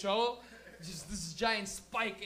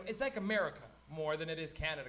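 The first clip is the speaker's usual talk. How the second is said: ordinary talk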